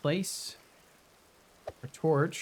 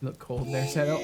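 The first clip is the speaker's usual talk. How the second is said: ordinary talk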